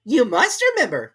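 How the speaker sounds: high, loud demand; high, loud stern king. A narrator's reading. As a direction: press the Hatter. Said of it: "remarked the king".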